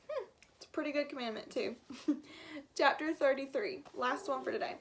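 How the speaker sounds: usual speaking voice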